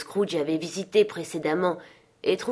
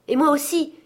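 higher, louder shout of me too; eager cry; 9 semitones higher, 5 dB louder than usual. A narrator's reading. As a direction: call it out eagerly, stress on moi. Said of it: "s'écrièrent un autre".

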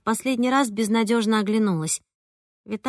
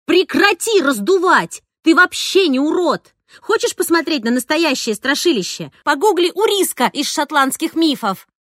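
put-on girl angrily lecturing a friend; angry outburst; 6 semitones higher, 5 dB louder than usual. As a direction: give him a furious telling-off. Angry outburst continuing the yell.

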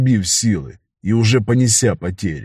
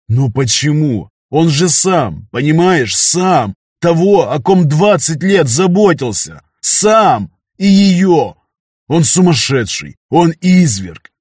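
rising, louder, anguished protest; distraught outburst; 5 semitones higher, 4 dB louder, slower than usual. A narrator's reading. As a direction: build to a desperate cry. Distraught outburst about murderer.

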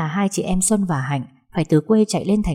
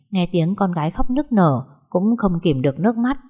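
ordinary delivery, nothing marked

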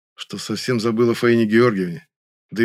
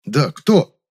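louder, higher, testy retort; irritated; 5 semitones higher, 6 dB louder than usual. A narrator's reading. Narrator insists Nyura name her mistress, irritated. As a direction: push back with irritation. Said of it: "Раздражённо прищурился я".

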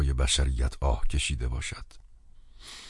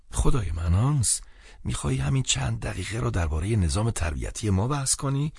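higher, mildly exasperated friend; lightly voiced exasperated friend; 6 semitones higher than usual. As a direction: protest with a touch of helpless impatience.

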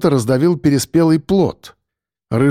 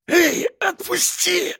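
very high, slow, softer strangled plea; choked wheeze; 5 dB quieter, slower than usual. A narrator's reading. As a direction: squeeze it out through a throttled throat. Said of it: "голос его сорвался на сип".

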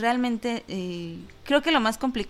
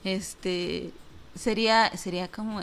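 normal talking voice

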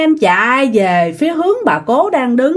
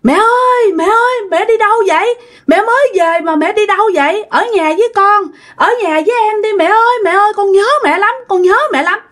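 put-on very high, pleading child's voice; acted high wail after a departing mother; desperate calling out; 11 semitones higher, faster than usual. A narrator's reading. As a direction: beg mom to stay, words tumbling out. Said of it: "ông nội mới kêu rằng".

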